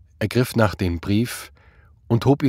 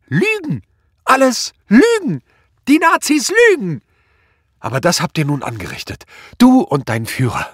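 high, loud grief-stricken shouting; screaming ever louder; 10 semitones higher, 7 dB louder than usual. A narrator's reading. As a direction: bellow your grief out into the world. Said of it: "Sie schrie immer lauter". (immer lauter herausgebrüllter Kummer)

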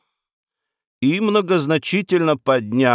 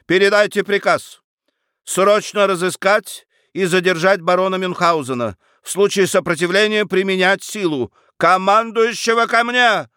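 commanding orders; 6 semitones higher than usual. Acted. Duke barking orders to adviser.

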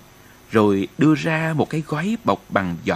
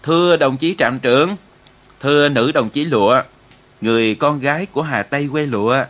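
formal toast, projected a little louder; solemn opening speech; 4 dB louder than usual. A narrator's reading. Commissar opening the New Year feast for the troops, solemn. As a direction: address the gathering ceremoniously. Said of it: "trịnh trọng nói lời khai tiệc".

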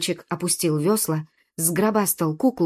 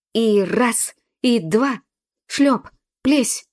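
loud, raised calls on each splash; effortful rowing count; 4 semitones higher, 4 dB louder, much slower than usual. A narrator's reading. Boy urging himself on at the oars, effortful rowing count. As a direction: count out the strokes with a punch.

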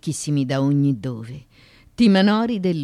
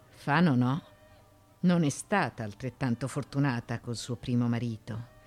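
ordinary talk

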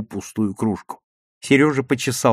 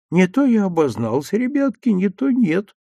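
mildly loud; 6 semitones higher than usual. A narrator's reading. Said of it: "громко сказала она".

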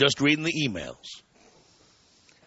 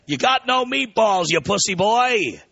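hint of an Italian accent, pitched up; higher mock-Italian insult, drawn out at the end; 7 semitones higher, 3 dB louder, slower than usual. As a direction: taunt in a hammed-up, light Italian accent. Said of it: "with an Italian accent".

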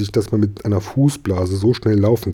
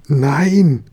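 higher, louder drawn-out protest; crying out; 5 semitones higher, 4 dB louder than usual. A narrator's reading. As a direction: call out a dismayed refusal. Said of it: "rief der Papa".

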